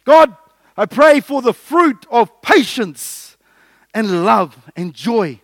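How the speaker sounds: loud, slow, earnest plea to God; fervent prayer. Acted. Preacher voicing a prayer to God, slow and loud. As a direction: stretch each word out like a prayer.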